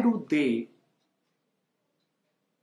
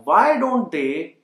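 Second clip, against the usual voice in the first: very slow, each word spaced for learners; teacher drawing out the question so students can repeat, very slow; slow model question; 6 dB louder, much slower than usual. Shown as a demonstration.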